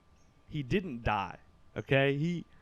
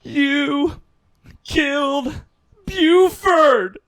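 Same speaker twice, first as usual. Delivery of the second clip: high shriek, one word at a time; piercingly high, very loud, halting wail; mom's scream; 12 semitones higher, 13 dB louder, much slower than usual. Retold or imitated. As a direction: imitate a mother screaming an accusation. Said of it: "That's what she just screams".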